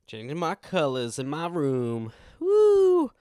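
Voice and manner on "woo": loud high whoop. Real, not acted. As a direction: let out a wild cheer.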